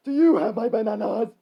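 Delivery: loud, high monkey demand; yelling monkey. Acted. Monkey demanding bananas from a traveler, loud yell. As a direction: demand it at full volume. Ferocious monkey questions the hero.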